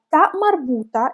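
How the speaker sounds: ordinary talk, nothing marked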